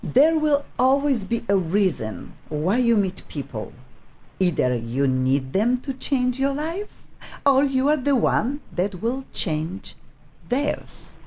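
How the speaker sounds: measured reading with weighty pauses; reading aloud. Real, not acted. Host reading an absent colleague's short piece, measured reading aloud. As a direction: read it out phrase by phrase, letting the last word hang. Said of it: "On that I would like to read".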